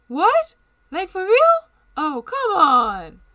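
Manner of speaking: high, loud incredulous outburst; loud surprise. Real, not acted. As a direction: protest in disbelief. Reacting to story twist.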